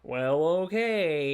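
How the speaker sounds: a hesitant fatherly okay; reluctant father. Retold or imitated. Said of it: "He's like".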